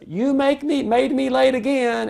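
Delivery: put-on high-pitched kid complaint; accusing kid. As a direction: whine it like a blaming teen. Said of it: "where the kid says".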